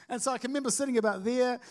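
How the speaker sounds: ordinary talk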